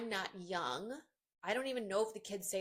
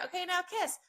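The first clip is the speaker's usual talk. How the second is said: cheery photo direction; 10 semitones higher than usual. Retold or imitated. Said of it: "I do the other couple".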